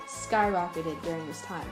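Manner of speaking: ordinary delivery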